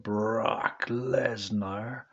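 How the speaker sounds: name drawn out slowly; mock announcer. Vlogger reads the manager's blurb, hyping the client's name, drawn-out. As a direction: stretch the name like a hype man. Reading Heyman's blurb, mimicking his catchphrase.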